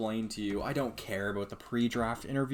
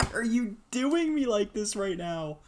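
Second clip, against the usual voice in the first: high, loud plea; louder high-pitched complaint; exasperated complaint; 11 semitones higher, 6 dB louder than usual. Real, not acted. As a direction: yell the question at the screen.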